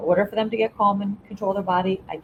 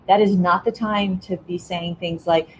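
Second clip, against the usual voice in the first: ordinary delivery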